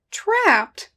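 high, loud disbelieving echo; incredulous. A narrator's reading. Hunter hears partners claim they're stuck, incredulous. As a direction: throw the word back in disbelief. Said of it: "Kramer said incredulously".

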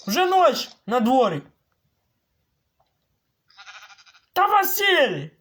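high, loud shouted demands; exasperated yelling. Acted. Gamer shouting at villager to help build. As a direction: yell at him to come help.

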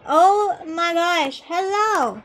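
slow, loud, high oh my gosh; excited greeting. Real, not acted. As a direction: gush over the arrival.

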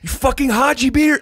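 high, loud mock yell at the doctor; retold yelling. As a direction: throw the insult up high and loud, reliving the moment. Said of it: "I'm, like, yelling Haji Beard".

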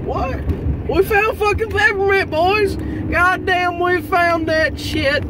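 high, loud, slow triumphant shout; excited yelling. Real, not acted. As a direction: shout it out like you struck gold. Excited shout over found peppermint.

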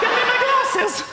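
squeaky mock Queen waking.

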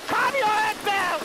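high, alarmed yelling; startled yell. Real, not acted. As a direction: yell at her to settle. Monster raging at players.

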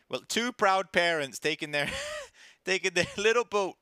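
loud, high, laughing pride; mock proud parent, laughing.

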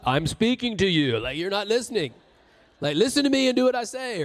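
loud, insistent God; God fed up, raising his voice; God scolding the deaf believer, loud; exasperated God. Retold or imitated. God exasperated, much louder.

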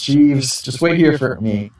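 ordinary talk

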